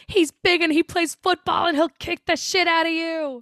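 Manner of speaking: put-on high, emphatic boyfriend boast; retold defiant threat. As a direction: act out her warning to back off.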